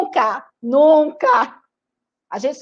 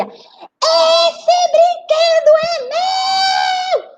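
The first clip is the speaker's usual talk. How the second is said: very slow, high, drawn-out claim; possessive child yell; 4 dB louder, much slower than usual. Acted. Rita refusing to share her toy, high and very slow. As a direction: hold every word like a spoilt kid. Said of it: "ela gritava".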